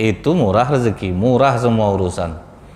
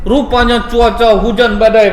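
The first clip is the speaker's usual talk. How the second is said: dramatic loud telling; 10 semitones higher, 5 dB louder than usual. A narrator's reading. Storm in the story.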